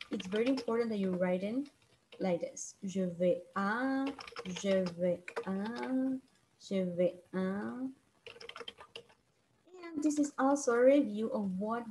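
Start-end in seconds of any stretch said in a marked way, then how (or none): 2.8-7.9 "je vais à" spelled out slowly, three times over; slow, careful model phrase for the class; slow drill. Shown as a demonstration. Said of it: "Remember to have that one".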